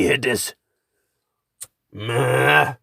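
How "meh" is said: ordinary talk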